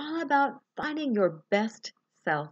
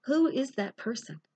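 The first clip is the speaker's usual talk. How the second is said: unhurried meditation guide, long pauses between phrases; guided meditation. Real, not acted. Coach leading listeners through a best-self visualization, calm guided meditation.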